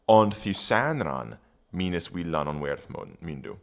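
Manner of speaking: put-on reading Old English aloud; careful Old English recitation. Shown as a demonstration. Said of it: "rereading the Old English".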